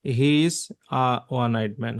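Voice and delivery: slow, spaced-out sentence; slow example read. Shown as a demonstration. Teacher slowly reading an example to the class. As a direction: read it word by word. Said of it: "হবে, আ হবে".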